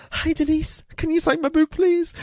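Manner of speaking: very high, squeaky starstruck fan; crazed fan. Retold or imitated. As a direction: squeak it like a gobsmacked superfan. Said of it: "looked like a crazed fan".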